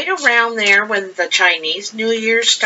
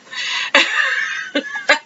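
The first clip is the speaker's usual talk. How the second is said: high airy giggle; breathy high giggle; 14 semitones higher, 4 dB quieter than usual. Real, not acted. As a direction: giggle in the middle of the quote. Laughs mid-quote.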